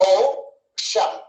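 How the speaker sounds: ఓషన్ slowly split as "ఓ... షన్"; slow syllable-by-syllable ఓషన్, in two beats. Shown as a demonstration. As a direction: say ఓ, pause, then షన్. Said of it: "రెండు వచ్చినాయి".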